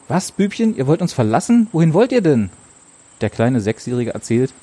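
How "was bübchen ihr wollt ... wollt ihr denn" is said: can't believe he's leaving; dismayed surprise. Acted. Ursel asks the boy why they are leaving, upset and higher.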